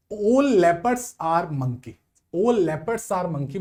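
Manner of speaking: very slow, word by word, reading out the statement; slow dictation. Shown as a demonstration. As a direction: spell it out slowly for the class. Teacher reads statement aloud. (बहुत धीरे, शब्द-शब्द पढ़ते हुए)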